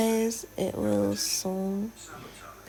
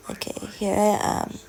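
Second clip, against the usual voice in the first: ordinary talk, nothing marked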